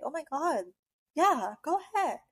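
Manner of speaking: ditz cheering a bad idea; mock gushing. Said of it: "people would be like".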